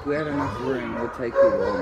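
rising into a very high coo; cooing pet talk. Real, not acted. Vlogger promising the shelter dog a home, very high coo.

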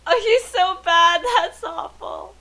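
squealing with laughter, high; laughing. Real, not acted. Player laughing at a terrible karaoke singer, high and laughing. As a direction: laugh through the words.

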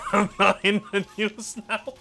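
high, through laughter; giggling protest; laughing denial. Real, not acted. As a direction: deny it while cracking up.

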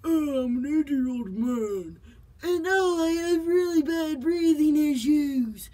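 put-on an old man short of breath, slowly; hammed-up breathless old man; wheezy old man. Shown as a demonstration. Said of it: "you combine".

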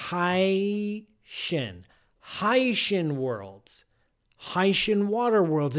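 "Haitian" slow, as HAY-shun. Shown as a demonstration. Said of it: "supposed to be pronounced".

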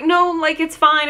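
high-pitched imitated reassurance; reassuring caller. Retold caller reassuring her.

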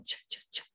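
ch, ch, ch repeated, whispered air; voiceless ch. Shown as a demonstration. Teacher drilling the voiceless ch. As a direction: tap out ch three times. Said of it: "We already practiced that one".

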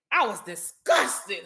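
slow, with DISGUSTED drawn out; emphatic disgust. Real, not acted. Vlogger telling viewers how she reacted, drawn-out stress on "disgusted". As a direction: lean hard and slowly on "disgusted".

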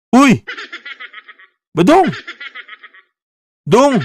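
high, laughing call of his name. Acted. Host calling sleeping guest Badong, mock-worried high call. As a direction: call him awake with a laugh.

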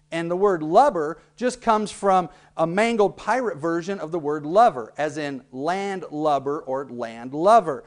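normal talking voice

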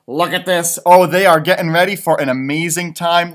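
plain reading voice

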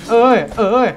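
panicking as enemies swarm; excited panic. Real, not acted. Enemies rushing in game.

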